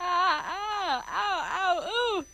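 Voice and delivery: high, loud wailing ows; shrill cockney squall of protest; squalling howls. Acted. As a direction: howl like a frightened flower girl. Liza squalls at being thrown out.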